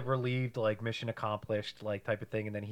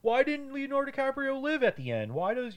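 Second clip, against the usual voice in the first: higher, whiny complaining moviegoer; mock a disappointed audience member; mock annoyed viewer; 11 semitones higher than usual. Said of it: "They're like".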